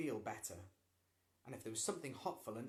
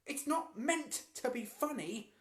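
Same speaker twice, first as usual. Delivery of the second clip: angry protest; 13 semitones higher, 8 dB louder than usual. A narrator's reading. Boy protesting angrily.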